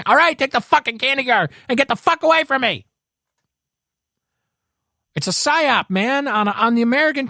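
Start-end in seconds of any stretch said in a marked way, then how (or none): 0.1-2.9 exasperated parent. Retold or imitated. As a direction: snap like a parent at the end of her rope. Worn-down parent snaps at kid.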